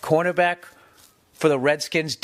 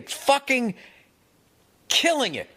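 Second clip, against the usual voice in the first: pitched up high, hyped and emphatic; raving emphasis; 8 semitones higher than usual. Real, not acted. Raving to friends about a great rocker's set, hyped up. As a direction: sell it like the best show ever.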